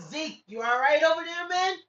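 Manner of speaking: calling out. Real, not acted. Calling to a friend across.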